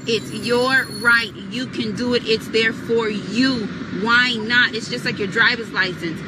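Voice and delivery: ordinary delivery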